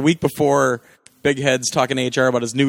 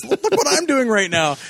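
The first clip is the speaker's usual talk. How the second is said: high-pitched, worked-up retold quote; mock outburst; 10 semitones higher than usual. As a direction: imitate someone showing off, pitched way up.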